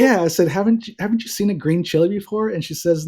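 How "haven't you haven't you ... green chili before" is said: higher-pitched incredulous question; retold frustration. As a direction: re-enact a frustrated challenge, pitched up. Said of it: "I get totally frustrated".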